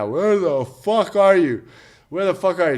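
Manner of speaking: searching backstage, upset; frantic searching. Retold or imitated. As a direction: call out for a lost father.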